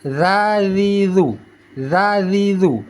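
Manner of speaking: "Za, zi, zu" with tongue root flat; light voiced TH in "Za, zi, zu"; thin za. Shown as a demonstration. Teacher showing the thin letter, "Za, zi, zu" said flat. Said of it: "Itu tipis karena pangkal lidah kita ratakan".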